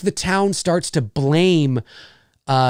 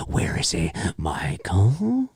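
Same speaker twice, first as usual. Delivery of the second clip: hushed, low, breathy call; eerie searching near-whisper; hunting Loomis; 9 semitones lower, 6 dB quieter, slower than usual. Acted. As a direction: creep down the hall calling softly. Acts Loomis hunting Michael in halls.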